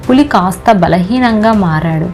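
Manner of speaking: plain reading voice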